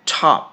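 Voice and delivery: টপ said as English top, short o; টপ with a clipped English o; saying top. Shown as a demonstration. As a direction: model word টপ. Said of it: "এখানে আমি উচ্চারণ করলাম টপ".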